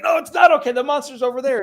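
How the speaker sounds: high, loud cry of alarm; alarmed mock panic. Acts out horror movie panic.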